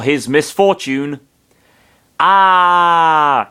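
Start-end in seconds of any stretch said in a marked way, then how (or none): 2.2-3.5 yell. Acted. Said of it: "he yelled inside his mind".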